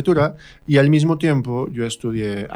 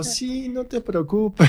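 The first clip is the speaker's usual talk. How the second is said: breezy reassurance; 11 semitones higher, much slower than usual. Retold or imitated. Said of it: "Y yo".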